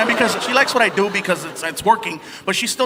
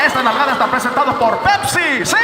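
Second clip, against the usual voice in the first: mock sponsor read; 3 semitones higher, 4 dB louder than usual.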